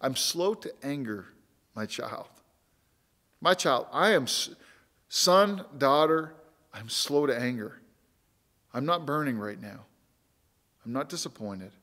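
put-on soft, gentle reassurance to a child; tender voice of God. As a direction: speak as God comforting his child, quietly. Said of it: "so he says, I'll say it clearly".

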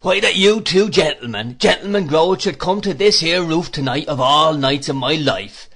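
loud, delighted rustic welcome; animated, pleased. A narrator's reading. Peggotty welcomes two gentlemen to his roof, animated and pleased. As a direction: gush loudly with pleasure, in dialect. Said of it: "with extraordinary animation and pleasure".

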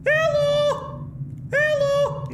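sing-song hello shouts; calling out. Real, not acted. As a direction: call out like into a dark hall. Calling out in game.

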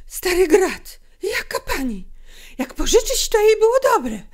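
high, loud, scornful tirade; angry outburst of name-calling; trembling with anger. A narrator's reading. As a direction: rant in anger at an insult. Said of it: "zawołała głosem drżącym z gniewu".

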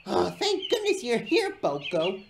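high, loud, theatrical relief; smug, high-pitched greeting; smirking. A narrator's reading. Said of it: "smirked James".

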